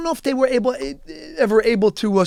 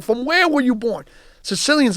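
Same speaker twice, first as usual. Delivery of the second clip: ordinary talk, nothing marked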